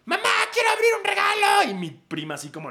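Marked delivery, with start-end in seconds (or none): 0.0-1.7 put-on high, loud kid shouting at mom; a spoiled kid bellowing for a gift; much higher and louder, begging mom; demanding child yell.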